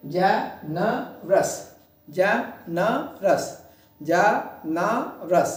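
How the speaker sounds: "जनरस" very slowly as je-ne-rous, with gaps; three separate beats in each "जनरस"; split into syllables. Shown as a demonstration. Teacher counting syllables for the class, syllable by syllable. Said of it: "both words contain three syllables".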